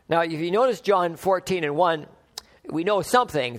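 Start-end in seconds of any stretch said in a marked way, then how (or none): none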